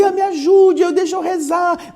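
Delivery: high, loud cry for help; shouting. A narrator's reading. Outsiders begging to be let in to pray, shouting. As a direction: wail it out from outside the door. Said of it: "Ficam lá fora gritando".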